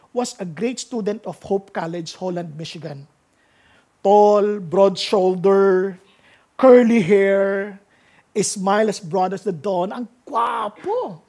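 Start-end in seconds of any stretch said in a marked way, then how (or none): 4.0-9.9 slow, loud, drawn-out praise; loud admiring. Acted. Preacher describing handsome man. 9.9-11.2 admiring exclaim. Acted. Exclaiming how handsome.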